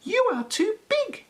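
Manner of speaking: very high squeaky mouse voice; tiny mouse squeak. A narrator's reading. Field mice turn Peppy away, very high squeak. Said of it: "they squeaked".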